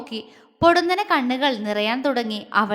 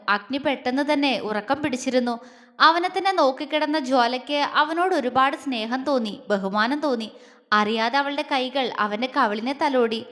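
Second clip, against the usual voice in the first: usual speaking voice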